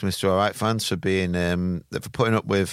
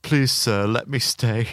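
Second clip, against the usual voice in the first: mock begging; 3 semitones higher, slower than usual. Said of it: "I was put my hands together, like, and went".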